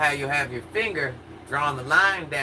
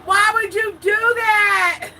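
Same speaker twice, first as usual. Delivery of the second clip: high, slow, drawn-out yell; angry yell; 7 dB louder, slower than usual. Retold or imitated. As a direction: yell it in disbelief. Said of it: "he was like".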